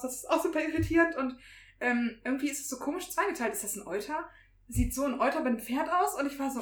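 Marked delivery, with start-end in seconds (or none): none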